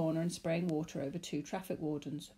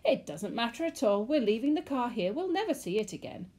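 high, gleeful relief; rising giddily as you throw caution away; jubilant, sing-song exclamation; laughing it off; 4 semitones higher, 5 dB louder than usual. A narrator's reading. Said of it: "He laughed out loud".

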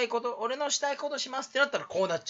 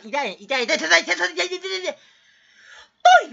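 higher, rapid repeated ouches; yelping in pain; 8 semitones higher, 8 dB louder, faster than usual. Acted. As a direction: yelp faster and higher as it hurts. (痛がって高い声で連呼)

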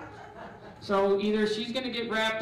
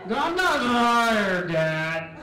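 put-on slow, louder bratty teen protest; whiny teen; 5 dB louder, much slower than usual. Kid talking back to Dad, loud and bratty. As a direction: sulk it out like a kid.

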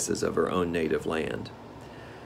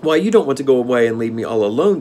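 put-on pleading girl; 5 semitones higher, 6 dB louder, faster than usual. Dorothy pleading with Scarecrow.

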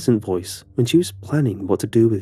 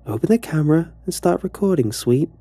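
higher, sickly-sweet order; sugary bully command; 8 semitones higher than usual. Acted. Alice orders him to film, in a high sugary command. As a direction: give the order with a poisonous sweetness.

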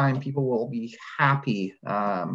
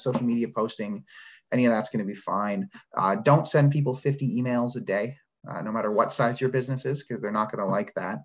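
usual speaking voice

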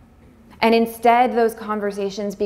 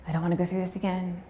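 ordinary talk

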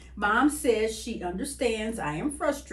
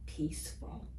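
whispered peaceful; 6 semitones lower, 12 dB quieter than usual. A narrator's reading. Reading to a child about rain, whispering peaceful. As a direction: softly breathe the word peaceful. Calm rainy day, feeling peaceful.

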